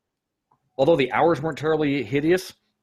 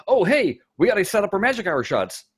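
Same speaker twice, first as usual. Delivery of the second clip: sudden panic; 9 semitones higher than usual. Retold or imitated. Crew suddenly remembering the magic hour shots.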